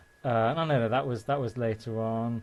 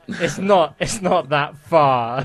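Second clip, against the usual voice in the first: loud, laughing mock exasperation; retold exasperated protest; 3 semitones higher, 6 dB louder than usual. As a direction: protest the tiny difference, amused and emphatic. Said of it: "And you're like".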